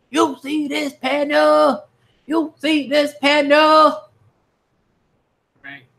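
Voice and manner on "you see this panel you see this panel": "You see this panel?" stretched out slowly, much higher; excited, high and slow. Shown as a demonstration. A reader pointing the viewers at a big panel, in a high, drawn-out hype voice. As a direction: pitch it way up and drag every word.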